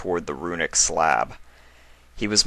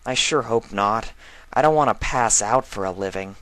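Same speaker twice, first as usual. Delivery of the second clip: a hint of a higher, glum young filly; half-joking little foal, a touch higher; lightly voiced filly; 5 semitones higher than usual. Filly answering her uncle, lightly voiced and a bit glum.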